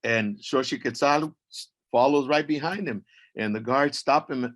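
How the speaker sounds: ordinary delivery, nothing marked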